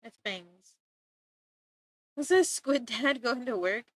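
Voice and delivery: ordinary talk, nothing marked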